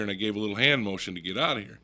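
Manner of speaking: ordinary talk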